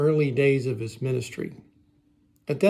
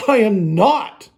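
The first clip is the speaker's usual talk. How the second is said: emphatic, louder and drawn out; indignant denial; 5 semitones higher, 6 dB louder, slower than usual. Acted. Peter denying Jesus to people by the fire, indignant. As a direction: deny it firmly, every word slow. Denying him again, more heated.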